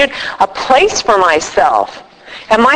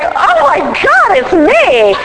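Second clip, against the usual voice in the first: much higher, slow hammed-up dismay; retelling seeing herself in the window, high mock horror; shocked realization; 8 semitones higher, slower than usual. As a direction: draw out the horror of recognizing yourself. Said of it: "And I was like".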